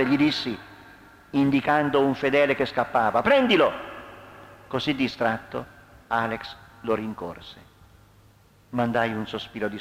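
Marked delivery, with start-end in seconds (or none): 3.2-3.7 high, loud command; sudden urgent yell; urgent shout. A narrator's reading. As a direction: send him running after someone. Ordering Alex to chase a fugitive.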